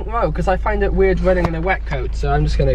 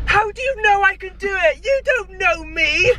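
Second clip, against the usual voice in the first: mocking whiny partner; 14 semitones higher than usual. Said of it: "And he went".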